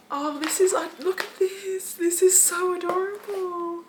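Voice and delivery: high, slow, squeaky adoration; touched cooing. Real, not acted. Bridesmaid-to-be looking at her gift, cooing. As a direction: coo over it, drawing the words out.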